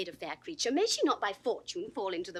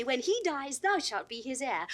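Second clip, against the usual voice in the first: normal talking voice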